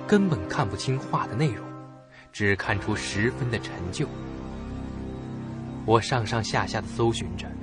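plain reading voice

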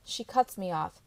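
usual speaking voice

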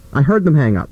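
normal talking voice

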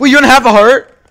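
loud, high disbelief; surprised shout. Real, not acted. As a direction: yell it in shock. Shocked shout in game.

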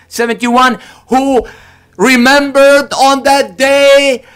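high, loud, slow preaching climb; impassioned preaching. Acted. Preacher to congregation, booming and slow. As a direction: build the sermon to a ringing peak. Preacher rising to full force.

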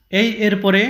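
usual speaking voice

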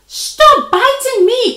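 high, loud protest; pained, indignant storybook shout; crying out. A narrator's reading. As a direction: yell at the one biting you. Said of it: "cried Spider".

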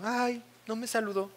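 hurt whimper. Retold or imitated. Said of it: "Y tú".

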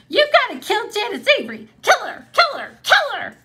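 high, loud, fast chant of kill her; a raging little sister yelling for revenge; furious child. Acted. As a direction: demand revenge like an enraged little girl. Furious little girl demands revenge.

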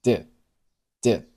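did twice with the final d shaped but silent; unreleased d. Shown as a demonstration. Teacher demonstrating lost plosion in did. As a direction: stop did without releasing the d. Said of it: "读成了".

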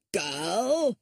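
put-on a grumpy old father barking for the girl; calling old man. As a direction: call her sharply like a crotchety old master of the house. Old father calls out to Lucy.

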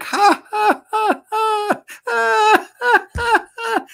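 very high cartoon cackle; a gleeful devil's squealing laugh; shaitan laughing. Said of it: "he will start laughing like".

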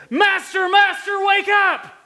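high, loud frantic cry to wake him; panicked shout. Retold or imitated. Disciples in the sinking boat shout at sleeping Jesus, panicked. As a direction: yell it like sinking men begging for help.

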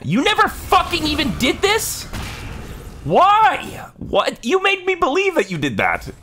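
shrieking at the screen, way up high; furious high yelling. Real, not acted. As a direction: scream your outrage at the enemy.

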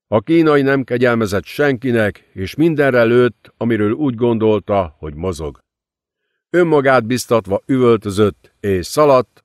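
ordinary delivery, nothing marked

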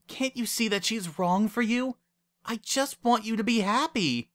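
high-pitched, exasperated appeal; desperate pleading. A narrator's reading. Wendy begging Lyra to see sense, pleading.

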